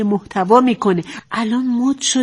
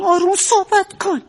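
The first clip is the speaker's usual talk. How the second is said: exasperated insistence; 8 semitones higher than usual. Acted. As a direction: raise your voice, fed up with repeating it. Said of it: "به چه زبونی بگم". (کلافه و با صدای بالا)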